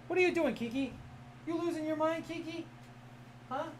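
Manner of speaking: high sing-song voice for a cat; playful pet talk. Real, not acted. Streamer asking his cat what she's doing, high playful pet talk. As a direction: tease a pet in a high, cooing voice.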